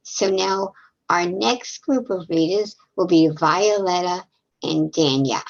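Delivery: ordinary delivery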